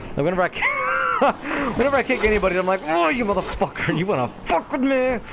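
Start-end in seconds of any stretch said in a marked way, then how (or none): 2.8-5.2 high-pitched imitation of an aggressive taunt; mock aggressive. Player imitating himself kicking someone, mock aggressive. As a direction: puff it up like picking a fight. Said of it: "I get so aggressive".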